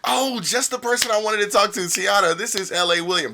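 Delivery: overly sweet greeting; killing with kindness. Shown as a demonstration. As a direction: answer rudeness with cheer. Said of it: "I kill people with kindness".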